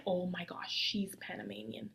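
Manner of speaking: half-whispered amazement to oneself; retold hushed realization. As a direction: drop to a whisper, as if thinking aloud.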